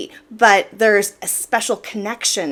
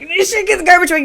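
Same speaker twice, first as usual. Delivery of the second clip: fast, higher and louder, building to his outburst; rising agitation; 9 semitones higher, 5 dB louder, much faster than usual. Retold or imitated. Retelling husband's garbage tantrum, fast and heated. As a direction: rush it up as his temper boils.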